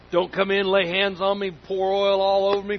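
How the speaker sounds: ordinary delivery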